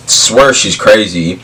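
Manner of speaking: ordinary talk, nothing marked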